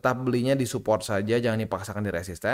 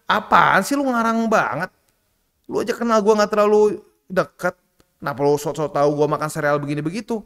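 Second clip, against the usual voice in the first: mock annoyed retort; 5 semitones higher, 5 dB louder than usual. Annoyed retort to a know-it-all.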